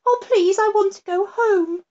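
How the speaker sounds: a hint of a tearful girl begging; the girl begs to go home, high and pleading; crying little girl. A narrator's reading. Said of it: "the little girl started to cry".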